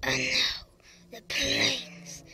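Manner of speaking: failed whispered villain announcement; super villain voice. Acted. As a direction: try a menacing villain whisper, half-hearted. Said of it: "I was gonna do the, like, this cool super villain voice, but it didn't work".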